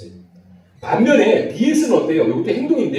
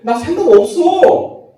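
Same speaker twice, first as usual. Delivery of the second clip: higher, louder, slow flat refusal from the wife; mock a wife brushing off the question; fed-up wife; 10 semitones higher, 8 dB louder, much slower than usual. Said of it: "뜬금없이 뭐 생각을 물어보는데". (질린 아내 흉내, 높고 크게 천천히)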